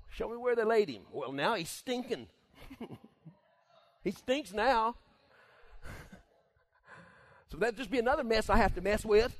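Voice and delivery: high, loud laughing grumble; preacher plays Martha grumbling at Jesus. Retold or imitated. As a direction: complain about the mess. Martha complaining about more mess.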